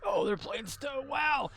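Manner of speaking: high, gushing excited viewer. Retold or imitated. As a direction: gasp it out with delight. Imitating excited newcomer viewers.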